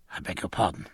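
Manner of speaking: small, low, soft apology; sheepishly quiet and low. Acted. Midshipman apologises in embarrassed silence.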